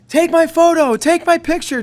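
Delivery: a light mock of friendly fans asking for a photo; warm and welcoming. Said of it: "warm and welcoming".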